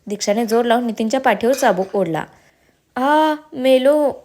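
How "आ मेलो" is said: a slow, drawn-out cry of pain, higher and louder; pained howl. A narrator's reading. Nitin howls in pain under the whip, drawn out. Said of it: "Nitin बोंबलला".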